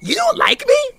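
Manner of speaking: very high, hurt, fast protest; wounded squeal. Acted. Stir fry protests to Ivan, very high and hurt. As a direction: squeak out betrayed disbelief.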